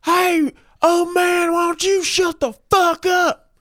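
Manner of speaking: put-on bully the old neighbor; drunk Doyle yelling. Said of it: "And Doyle goes".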